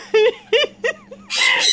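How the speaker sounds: very high squealing laugh; helpless laugh. Real, not acted. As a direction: burst into shrieking laughter. Laughing at a joke gift.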